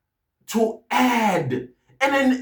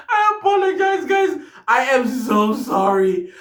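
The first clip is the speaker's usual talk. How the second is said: laughing; 5 semitones higher than usual. Real, not acted. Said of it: "I'm teary because I've been laughing".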